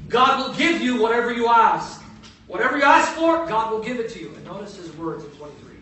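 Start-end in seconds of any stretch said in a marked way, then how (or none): 0.1-4.3 much louder, higher ringing proclamation; emphatic preaching. Shown as a demonstration. Preacher proclaiming Martha's faith to the congregation, loud and emphatic. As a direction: proclaim it with full force. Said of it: "go back to verse twenty-three".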